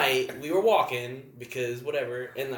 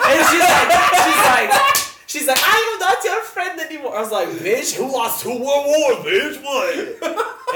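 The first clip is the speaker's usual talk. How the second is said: high, loud, hammed-up drunk quarrel; loud laughing retelling; 13 semitones higher, 12 dB louder than usual. Retelling a bar fight to friends, loudly hammed up. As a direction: yell the retold fight through laughter.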